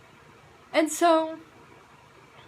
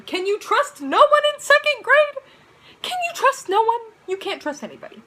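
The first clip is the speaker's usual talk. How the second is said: hammed-up crying wail, much higher and louder; mock wail; 11 semitones higher, 8 dB louder than usual. Kid betrayed by friends, mock crying wail. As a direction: ham up a betrayed kid's sobbing.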